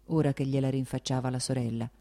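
plain reading voice

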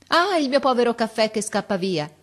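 a sudden high, loud cry of alarm; boy crying out over his coffee boiling over. Acted. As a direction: blurt it out as the coffee boils over. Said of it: "gridò all'improvviso".

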